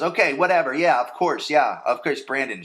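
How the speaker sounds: mock flustered brush-off close to tears; defensive brush-off. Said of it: "she's about to cry".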